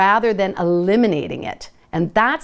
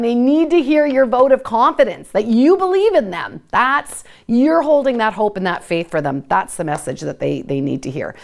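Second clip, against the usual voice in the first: ordinary talk, nothing marked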